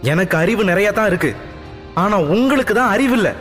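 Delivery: high, heated snap back; angry retort. Acted. Daughter angrily answers her father, high heated retort. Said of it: "கோபம் தலைக்கேறியது".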